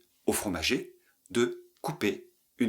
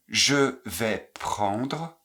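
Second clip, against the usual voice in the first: je vais prendre, very slow and separated; slow syllable drill; much slower than usual. Shown as a demonstration. Teacher drilling students, very slowly. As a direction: stretch each syllable out slowly. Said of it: "vous les répétez exactement comme je les prononce".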